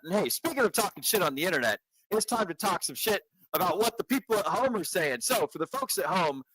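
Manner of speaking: hype host. Acted. Hyped host intro segment.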